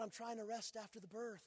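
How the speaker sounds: soft and weary, worn out; drained new mother pleads to rest; tired wife. Retold or imitated. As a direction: say it like you can barely lift your head. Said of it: "she's all tired".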